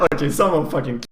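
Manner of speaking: real laughter spilling into the words; laughing. Real, not acted. Laughing at his own stupid mistake. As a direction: laugh through it at your own blunder.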